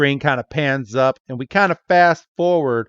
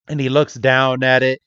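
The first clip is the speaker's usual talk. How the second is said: ordinary delivery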